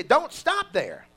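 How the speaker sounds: "Don't stop there." slow and loud, "stop" punched; stress on stop. Shown as a demonstration. Preacher giving the congregation words to repeat, slow and loud. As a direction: lean hard on "stop" and draw it out. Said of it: "Look at somebody and say".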